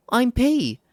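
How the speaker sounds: high, bright little fairy cheering. Acted. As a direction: squeak it out like an excited tiny fairy. Fairy P introduces herself.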